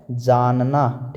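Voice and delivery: stretches जानना out slowly; teacher drawing out जानना for the class; slow answer. Shown as a demonstration. Teacher answering pupils, slow drawn-out जानना. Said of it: "क्या होता है".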